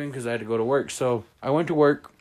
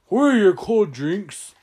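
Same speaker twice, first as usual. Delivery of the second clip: slow, higher, louder clueless question; dumb customer repeated; 8 semitones higher, 6 dB louder than usual. Retold or imitated. Rude customer asking the boss, slow and clueless. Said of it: "and says".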